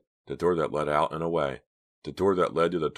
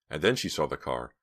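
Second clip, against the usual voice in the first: ordinary delivery, nothing marked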